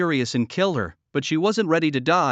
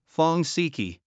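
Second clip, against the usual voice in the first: plain reading voice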